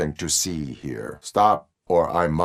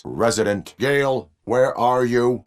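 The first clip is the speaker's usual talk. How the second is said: calling out; 6 semitones higher, 4 dB louder than usual. Acted. Calling out for a resident.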